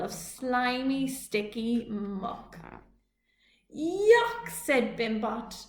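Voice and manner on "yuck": high, loud squeal of disgust; disgusted yuck. Acted. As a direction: recoil from the slime. Disgust at muck.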